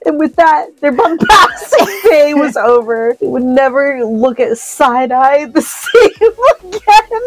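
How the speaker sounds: high, loudening finish as laughter takes over; reading through laughter. Real, not acted. Reader finishing the story for a friend, helpless laughter. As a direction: push to the ending while cracking up.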